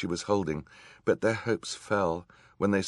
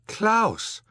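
very high, loud exclamation; astonished cry; 8 dB louder than usual. A narrator's reading. Sisters stunned by their brother, high astonished cry. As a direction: cry out the name, aghast. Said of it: "cried in simultaneous astonishment".

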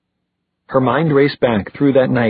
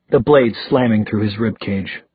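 usual speaking voice

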